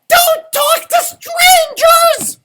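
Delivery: high squealing warning yell; chicken's shout. A narrator's reading. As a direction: screech it like a tiny alarm. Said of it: "and she said".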